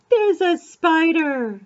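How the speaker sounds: put-on a drawn-out discovery for a small child; playful amazed reveal; mock surprise. Gasping mock surprise at picture book.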